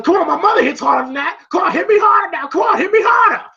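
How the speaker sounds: high mock shout, climbing in pitch; goading taunt. Rocky daring Clubber to hit harder, goading. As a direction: egg on an opponent, imitating a boxer.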